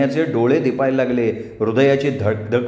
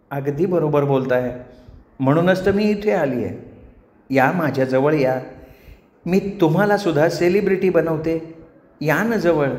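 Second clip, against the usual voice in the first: sweet, coaxing celestial temptress; alluring apsara; 3 semitones higher than usual. Acted. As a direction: lure him closer in a honeyed feminine voice. Voices Menaka luring Ganya. (लाडिक, मोहक अप्सरेचा आवाज)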